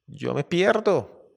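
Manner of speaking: lion's slow, high cry of getting away; lion making his escape. Acted. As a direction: draw it out slowly and high, ready to bolt. Lion decides to flee the hunters.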